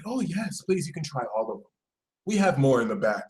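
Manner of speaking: soft, smooth offer; coaxing tempter. Acted. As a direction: usher them in quietly. Dark side coaxing Sagittarius.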